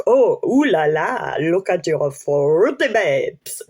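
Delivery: hammed-up French accent with a drawn-out finish; bad French accent. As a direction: do a dreadful French flirt accent. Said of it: "That was a really shit French accent".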